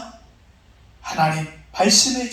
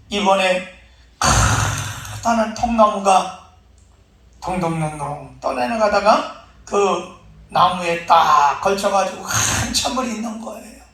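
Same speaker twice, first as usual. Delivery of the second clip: ordinary delivery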